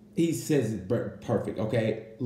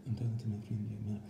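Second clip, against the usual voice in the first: low, soft mumble of a commentator; Simon impression; 4 semitones lower, 13 dB quieter, faster than usual. Commentator Simon answering from pit lane, in a low soft mumble. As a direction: mumble it low and quiet like a muffled broadcast voice. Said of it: "I had to get that impression in".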